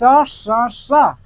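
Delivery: स said loud and slow; teacher stretching स for the class; स demo. Shown as a demonstration. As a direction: hiss the स out clearly. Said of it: "तो बोलना कि स".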